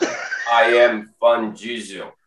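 grave e bem lento, voz de durão; voz de Van Diesel. Retold or imitated. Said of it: "Ele fala grosso". (imitação grave e lenta do Van Diesel)

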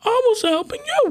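very high squeaky I was helping you; high-pitched repeat. Retold or imitated. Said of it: "She's like".

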